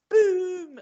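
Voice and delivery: playful shouted cannon boom. Pretend. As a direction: fire off the cannonball with a big boom. Imitating cannonball in pirate game.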